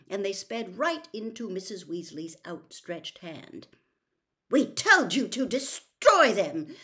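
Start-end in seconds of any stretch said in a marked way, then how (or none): 4.5-6.7 loud, slow, higher, stress on destroy; furious. A narrator's reading. Mother confronts sons over hidden toffees, furious. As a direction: spit out each word in fury. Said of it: "said Missus Weasley furiously".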